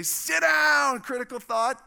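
high, loud order to sit; forceful, commanding yell; shouted command. Acted. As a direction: shout it down like an unruly thought. Preacher orders a thought to sit.

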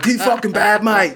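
loud, high Aussie comeback; mock Australian accent. Teasing neighbour who begged him to stop, mock Aussie accent. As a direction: refuse in a hammed-up Australian voice. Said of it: "my accent is almost gone".

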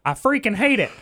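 Mickey Mouse voice. Retold or imitated. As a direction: imitate the bartender's high cartoon-mouse voice. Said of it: "this Mickey Mouse voice".

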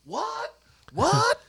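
hammed-up shrill what; mock squeaky indignation. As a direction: pipe up in outraged disbelief. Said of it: "And he's like".

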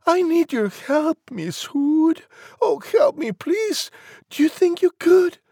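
very high, loud piglet squeal; frantic high-pitched plea; pleading pig cry. A narrator's reading. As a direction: beg for help in a squeaky pig voice. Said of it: "Pig cried".

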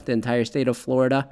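usual speaking voice

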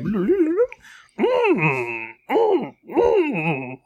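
very slow, high creature voice; hum, then drawl like Yoda. Pretend. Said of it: "Yoda, me name is".

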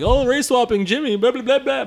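high-pitched imitation of whiny complainers; mocking gripe trailing into blah-blah; mock complaining. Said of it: "People were already complaining about".

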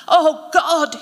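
loud, high cry of pain; anguished moan. A narrator's reading. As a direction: moan it out loudly in agony. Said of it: "he moaned".

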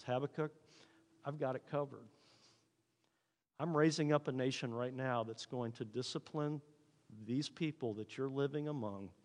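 softly voiced God, unhurried and assured; calm reassurance. God tells Habakkuk it is covered, calm and soft. God calmly answers the worried prophet.

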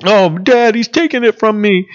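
high-pitched mock complaint to Dad; Esau blaming his brother. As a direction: imitate an upset son tattling to his father. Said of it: "in his emotion, trying to blame his brother".

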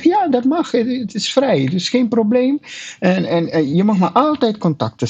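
bright high reassuring trainer; a lightly put-on high friendly voice; overly friendly reassurance. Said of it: "Ik zeg".

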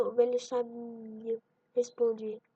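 ordinary talk, nothing marked

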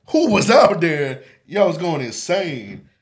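eager question. Retold or imitated. Asking classmates what he missed, loud and eager. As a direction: demand the news from classmates. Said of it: "I'm like".

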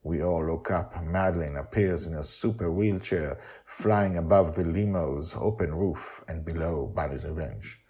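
plain reading voice

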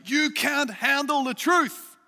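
hammed-up American film accent, punch truth; Jack Nicholson American accent. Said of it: "did you like my American accent there".

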